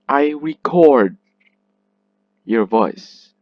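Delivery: record as a verb, re weak, CORD long and loud; stress on second syllable. Shown as a demonstration. Teacher reading the verb example sentence, stress on CORD in record. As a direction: slowly read record with a strong, drawn-out CORD. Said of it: "let me read that sentence".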